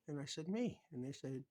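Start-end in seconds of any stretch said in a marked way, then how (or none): 0.5-0.8 high, simple me; innocent reply. Retold or imitated. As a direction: answer lightly as if it's obvious. His own answer in retold story.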